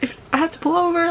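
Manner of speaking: high and fast, panicking; a panicky driver talking to herself; panicked. Retold or imitated. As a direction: rattle it off in a frightened rush. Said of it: "I was freaking out".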